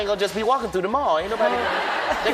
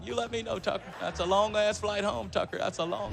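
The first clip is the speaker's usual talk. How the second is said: Bill Clinton impression; 2 semitones lower, 8 dB quieter than usual. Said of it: "He said".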